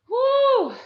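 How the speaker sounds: loud, high whoop; whooped "woo". Real, not acted. Coach cheers the team as the round ends, loud high whoop. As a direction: let out a big high-pitched "woo".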